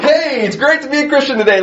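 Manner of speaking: high, fast, fake-bright cheer. Preacher mocking Christians with forced smiles, sing-song fake cheer. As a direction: chirp it like a grinning idiot. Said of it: "If all we just walked around like".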